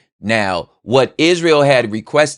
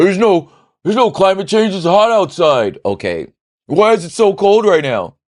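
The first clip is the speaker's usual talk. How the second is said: high, loud, scoffing know-it-all voice; mock climate denier; 11 semitones higher, 4 dB louder than usual. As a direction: ham up a smug denier shouting his point. Mocking a climate change denier.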